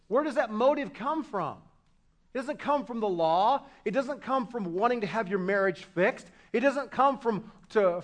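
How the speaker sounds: usual speaking voice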